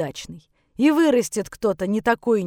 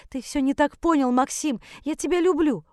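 higher, urgent mother's plea; pleading; 6 semitones higher than usual. Acted. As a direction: plead with a son pulling away. Mother pleads as son pulls away. (умоляюще, высоким голосом)

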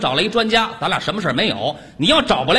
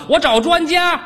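higher, more forceful repeat; insistent, high-pitched calling; raised voice; 7 semitones higher than usual. Acted. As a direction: pitch it up to be heard down the phone. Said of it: "你大点声啊".

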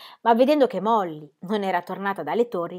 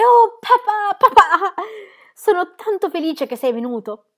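high, loud, near-sobbing joy; a child clinging to her father, overwhelmed; tearful cry of relief; 12 semitones higher, 10 dB louder than usual. Acted. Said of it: "E si mise a piangere".

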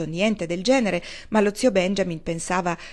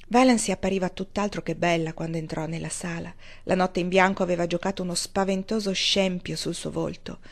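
ordinary delivery

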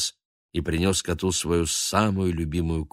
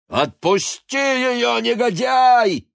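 much higher, louder slow angry cry; furious shout; 6 dB louder, slower than usual. A narrator's reading. Baguette shouting at Noir from the window, furious. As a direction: yell it down from the window. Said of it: "крикнул Багет".

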